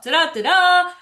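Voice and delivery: sing-song ta-da; mock fanfare. Shown as a demonstration. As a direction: announce it like a little fanfare. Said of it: "mock fanfare".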